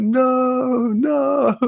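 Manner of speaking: a doomed guard squealing at a steamroller; panicked henchman. Retold or imitated. Retelling a movie guard facing a steamroller, high panicked squeal.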